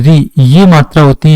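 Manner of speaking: usual speaking voice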